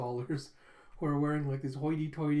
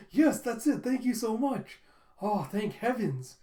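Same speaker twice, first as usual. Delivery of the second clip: high, loud gushing thanks; hammed-up gratitude, slowing at the end; effusive relief; 8 semitones higher, 5 dB louder, slower than usual. Father gets the lost keychain back, effusively relieved.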